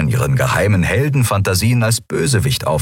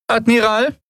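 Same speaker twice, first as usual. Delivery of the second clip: high, questioning call of a thin lieutenant; lieutenant's voice. Acted. Lieutenant addressing the admiral, high questioning call. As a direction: call him out of his thoughts, much higher. Aran calls Telios in the foyer.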